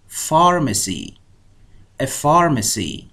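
Pharmacy said slowly, the PH a clean f sound, "farmesi". Shown as a demonstration. Teacher showing students that PH sounds like f, slow. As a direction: stretch out Pharmacy and open it with a clear f.

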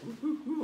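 high monkey ooh calls; monkey hoots. Shown as a demonstration. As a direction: sound like a monkey for the kids. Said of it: "what's next".

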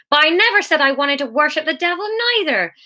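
high, bleating protest; bleated. A narrator's reading. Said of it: "Martin Young bleated".

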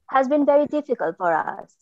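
ordinary talk

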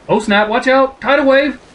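alarmed shout. Acted. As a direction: shout a warning about the wave. Reading alarmed warning of wave.

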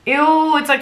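high disgusted "ew"; disgusted squeal. Real, not acted. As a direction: recoil at it. Cringing at her old fanfic.

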